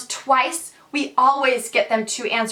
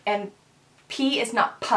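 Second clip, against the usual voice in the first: ordinary talk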